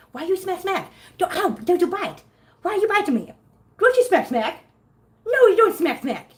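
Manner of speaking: high, mock-stern pet scolding; high cutesy reprimand. Real, not acted. Playfully scolding a biting kitten.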